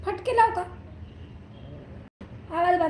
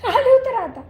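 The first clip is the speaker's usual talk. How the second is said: louder and higher, a firm scolding order; stern command; 8 semitones higher, 6 dB louder than usual. Real, not acted. Owner sternly orders the pet off her. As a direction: raise your voice and mean it.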